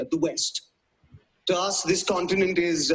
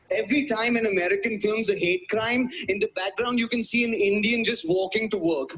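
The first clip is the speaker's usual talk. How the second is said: ordinary delivery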